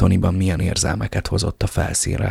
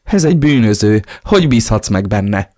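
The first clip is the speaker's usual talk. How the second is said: alarmed protest; 2 semitones higher, 6 dB louder than usual. Acted. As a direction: protest in a panic. Wife protests against the criminal. (riadtan tiltakozva)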